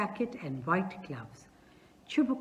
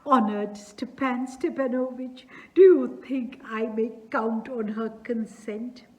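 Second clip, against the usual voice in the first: a touch of eager emotion, louder; greatly moved; 5 semitones higher, 7 dB louder than usual. Acted. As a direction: ask with hopeful urgency. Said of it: "greatly moved".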